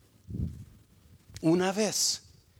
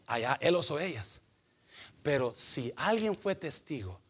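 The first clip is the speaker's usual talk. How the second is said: usual speaking voice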